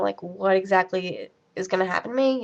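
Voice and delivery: ordinary delivery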